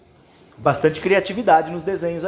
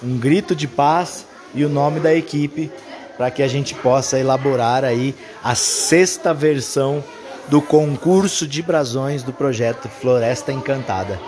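announcer voice; 3 semitones lower, 4 dB louder than usual. Acted. Closing announcement for video.

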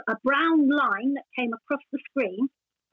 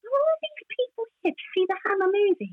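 mild amazement; 8 semitones higher than usual. Retold or imitated. Amazed at crowded theatre.

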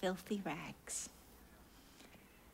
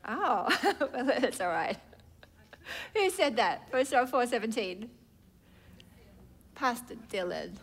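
ordinary talk

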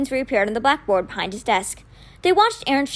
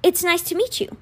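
higher, slightly nervous greeting; shaking a little; 7 semitones higher than usual. A narrator's reading. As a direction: swallow your fear and greet politely. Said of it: "his voice shaking only a little".

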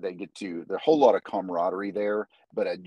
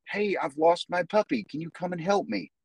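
put-on higher, sweet-talking stranger baiting a child; luring stranger; 9 semitones higher than usual. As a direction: coax like a stranger with a lost puppy.

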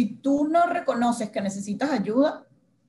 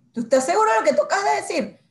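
much higher, louder, quick disbelief; incredulous; 9 semitones higher, 4 dB louder, faster than usual. Retold or imitated. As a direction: ask it like you can't believe him. Confronting boss about his decision. (incrédula ante el jefe)

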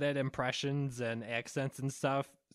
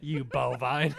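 laughing chef insult; 2 semitones higher, 7 dB louder, slower than usual. Pretend. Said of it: "that's what he would say".

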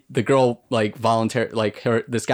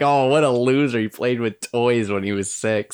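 snooty imitation of a friend; lightly voiced sneering tease; mocking friend's voice. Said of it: "You're like".

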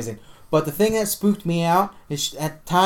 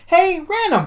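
much higher, slow, faux-innocent; mock casual ask; 5 dB louder, slower than usual. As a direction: play it cool but pitch it way up. Retold casual question to girlfriend.

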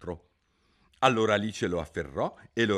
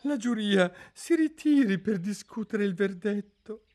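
faint, trembling voice; 14 semitones higher, 6 dB louder than usual. A narrator's reading. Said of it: "con voce fioca e tremante".

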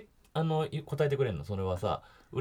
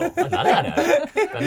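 higher, louder what the hell; indignant Kansai outburst; 5 dB louder, much slower than usual. Real, not acted. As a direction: burst out in disbelief. Indignant about being rebuffed. (納得いかずに声を張る)

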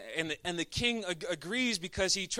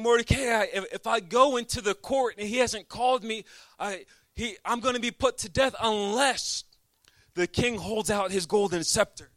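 pleading outcry; 4 semitones higher, 6 dB louder than usual. Acted. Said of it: "she's saying".

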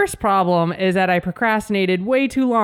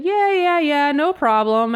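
high, slow careless "yeah, yeah"; breezy dismissive yes; 7 semitones higher than usual. Retold or imitated. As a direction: toss it off like a bored clerk. Quoting airline staff.

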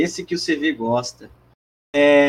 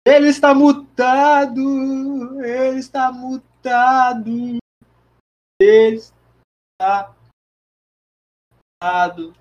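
loud, slow, higher call to a muted friend; 10 semitones higher, 4 dB louder, much slower than usual. Real, not acted. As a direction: call out slowly to get attention.

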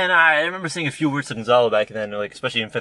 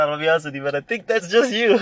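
mock blunt friend; 6 semitones higher, 6 dB louder than usual. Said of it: "it's like".

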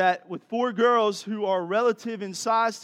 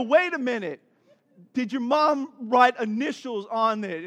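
higher, louder dad questioning; puzzled challenge; 5 semitones higher, 6 dB louder than usual. Retold or imitated. Dad judging his girls' case, puzzled challenge. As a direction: stop everything with a baffled query.

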